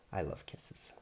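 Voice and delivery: whispered little confession; soft whisper aside. Real, not acted. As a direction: lean in and whisper it. Reader's aside to child.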